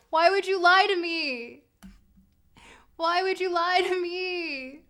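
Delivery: fake-weep the betrayal; mock crying. Streamer fake-sobbing at chat after a wrong guess, hammed-up. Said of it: "I'm not about to cry".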